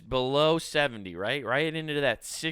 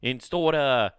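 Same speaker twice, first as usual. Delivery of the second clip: louder, higher mock-Australian voice; Australian accent; 4 semitones higher, 5 dB louder than usual. Australian TV telling adults to behave, in a put-on Australian accent. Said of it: "it's Australian".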